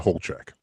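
Holchek in two syllables, HOLE-check; childhood pronunciation. Shown as a demonstration. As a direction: say Holchek with a long o and no middle vowel. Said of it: "I always pronounced it".

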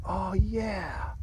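long, sighing moan of pleasure; acted blissful sigh, falling away; savoring appreciation. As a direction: sink into it with drawn-out, grateful relish. Acts out feeling appreciation.

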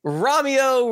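high, lifted stage call of the name; a mock theatrical cry of Romeo; calling Romeo like a mock Juliet; hammed-up Romeo call. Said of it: "Typical Shakespearean tragedy".

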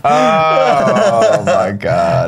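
long drawn-out exasperated cry; very slow, overwhelmed outburst. Real, not acted. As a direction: stretch it out in dismay. Butt of the joke reacts.

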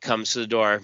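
ordinary talk

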